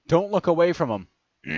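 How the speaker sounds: high, loud urgent warning; narrated shout. A narrator's reading. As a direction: call out sharply to a fighter. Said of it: "I heard Last shout".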